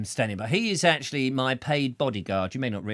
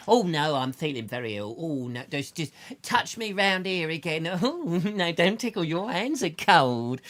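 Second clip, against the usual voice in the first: voicing the patient; 3 semitones higher than usual.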